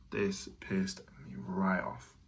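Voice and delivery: slow, hushed fury under the breath; whispered, slow and fuming; angry whisper. Real, not acted. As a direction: seethe it in a whisper. Angry trader muttering at screen.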